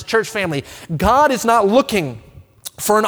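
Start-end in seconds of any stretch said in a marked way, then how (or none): none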